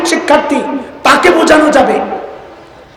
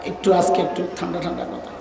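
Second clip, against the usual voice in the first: quiet request; 6 semitones lower, 13 dB quieter, faster than usual. Retold or imitated. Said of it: "Harun ভাই আমারে বহুত কইছে".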